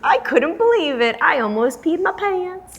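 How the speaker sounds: high-pitched excited disbelief; thrilled. Real, not acted. Host telling of finding the Cutco scissors, thrilled. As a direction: gush about a lucky find. Exclaiming over her lucky find.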